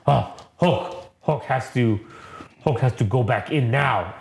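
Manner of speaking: halting brute with broken starts; dazed Hulk voice. Retold or imitated. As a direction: imitate a confused giant retreating. Dazed Hulk withdrawing, retold.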